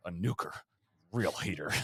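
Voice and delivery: low, soft Tiger Woods voice; Tiger impression. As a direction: do a deep, understated Tiger, dropping the volume. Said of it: "that he would describe as".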